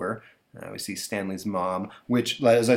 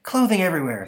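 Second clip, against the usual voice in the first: mom voice; 8 semitones higher, 4 dB louder, faster than usual. Acted.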